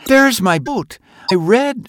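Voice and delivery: put-on high, eager child voice; a boy who just spotted his lost boat; high child's voice, eager; excited little boy. A narrator's reading.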